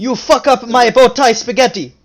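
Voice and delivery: hammed-up Italian accent, furious about bow tie pasta; angry Italian accent. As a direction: yell it like an offended Italian brother. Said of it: "that's my best Italian accent".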